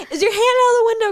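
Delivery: very high, loud, fast mom alarm; a startled mother asking urgently. Retold or imitated. Mom asking the kid about her hand, high alarmed. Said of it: "my mom was like".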